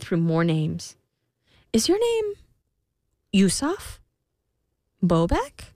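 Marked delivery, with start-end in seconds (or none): 1.7-5.7 rising, hopeful queen guesses; hopeful guessing. A narrator's reading. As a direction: try each name hopefully.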